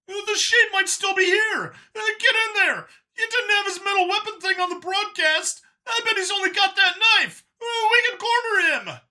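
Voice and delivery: very high, loud alien guard shout; shouting creature voice. Acted. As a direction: bark orders in a high shrill alien voice.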